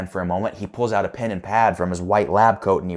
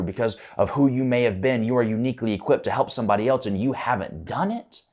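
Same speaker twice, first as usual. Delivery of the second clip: ordinary delivery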